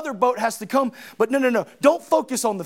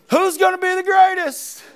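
higher rhetorical cry on gonna; 6 semitones higher than usual. Shown as a demonstration. Preacher challenging the church with a higher rhetorical cry. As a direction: lift "Who's gonna be the greatest?" higher as a challenge. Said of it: "question restated as a rhetorical cry".

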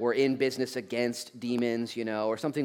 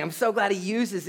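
usual speaking voice

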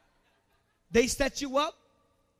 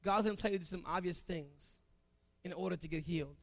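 softer repeat; 6 semitones lower, 9 dB quieter than usual. Shown as a demonstration. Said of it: "repeated softer".

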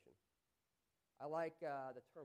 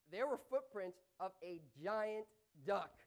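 slow, louder, rising in amazement; astonished; 3 semitones higher, 8 dB louder, slower than usual. Shown as a demonstration. Preacher shows astonishment at giant duck footprints. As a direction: marvel at it like you can't believe it.

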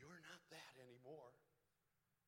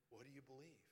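ordinary delivery

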